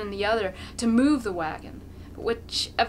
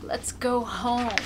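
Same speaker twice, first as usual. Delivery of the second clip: gentle father; 3 semitones higher, slower than usual. A narrator's reading. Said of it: "his father says".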